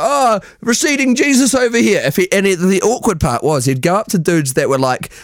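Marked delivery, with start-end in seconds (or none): 0.0-2.0 put-on excited drunk mate. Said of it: "we'd be like".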